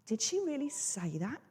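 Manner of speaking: softly incredulous, rising sharply at the end; a hushed question that swoops up on "that"; doubting disbelief. Acted. Doubting a friend could have said it, hushed disbelief. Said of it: "makes it sound like I doubt".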